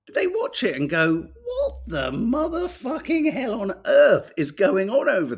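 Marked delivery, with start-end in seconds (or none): 1.4-5.4 high, incredulous outburst; mock aghast outcry; mock horror. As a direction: play a leader gaping at the chaos. Imagined leaders' horrified reaction.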